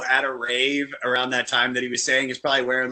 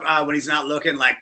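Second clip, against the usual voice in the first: ordinary delivery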